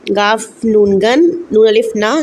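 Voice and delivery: drawn-out letter by letter गन्ना; slow spelling out. Shown as a demonstration. Teacher slowly spelling गन्ना for kids. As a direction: spell गाफ नून गन slowly, sound by sound. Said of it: "मसवटे आया है अलिफ गुन".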